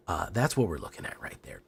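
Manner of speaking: ordinary delivery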